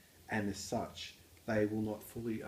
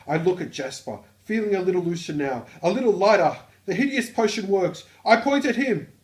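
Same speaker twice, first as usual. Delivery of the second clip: loud, high-pitched Australian; Australian accent; 9 semitones higher, 13 dB louder than usual. Acted. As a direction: belt it out in a broad, hammed-up Aussie accent. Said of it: "proper use of an Australian accent".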